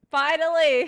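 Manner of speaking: high, loud triumphant burst; loud joking cheer; excited shout. Real, not acted. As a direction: yell it out in mock relief. Reacts gleefully in chat.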